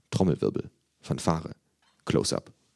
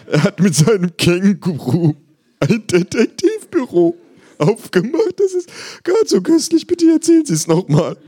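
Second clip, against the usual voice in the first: gleeful psychiatrist; 9 dB louder than usual. Acted. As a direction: gush as if the story is hilarious. Psychiatrist voiced, amused.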